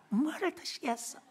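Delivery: high, honeyed flight attendant; sweet stewardess voice. Retold or imitated. A hint of stewardess asking passengers, sweetly. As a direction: coo it like a songbird. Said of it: "꾀꼬리가 달아".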